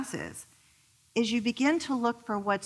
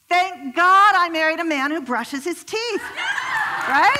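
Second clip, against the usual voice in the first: loud excited punchline; 8 semitones higher, 6 dB louder than usual. Real, not acted. Punchline delivered loudly to audience.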